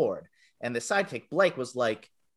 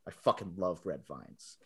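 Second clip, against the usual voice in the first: low, slow, softer deadpan declaration; 7 semitones lower, 8 dB quieter than usual. Retold or imitated. The bully unexpectedly loving Red Vines, slow deadpan. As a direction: drop it low and slow after a beat. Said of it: "takes a beat and says".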